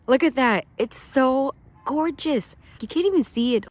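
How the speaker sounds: loud, high, gushing wonder; real excitement. Real, not acted. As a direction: exclaim loudly, stretching out the praise. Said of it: "I get excited about nature".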